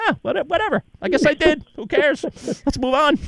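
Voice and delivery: lightly voiced whatever, who cares; mock shrug. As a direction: imitate a friend brushing it all off. Cohost shrugging off teasing.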